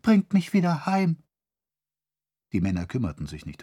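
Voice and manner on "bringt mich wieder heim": much higher, louder frightened pleading; trembling plea. Acted. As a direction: beg to go home, voice shaking. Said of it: "mit bebender Stimme".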